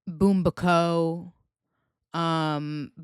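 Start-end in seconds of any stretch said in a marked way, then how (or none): 0.1-1.3 Boombaco slowly as BOOM-buh-ko; mangled surname. Retold or imitated. Podcaster quotes people's mistakes, slow BOOM-buh-ko. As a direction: say Boombaco with a weak middle vowel. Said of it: "People have said".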